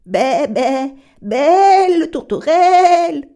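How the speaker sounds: loud, high, slow bleating sheep. Acted. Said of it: "Même le mouton, à son tour, essaya". (bêlement de mouton aigu et lent)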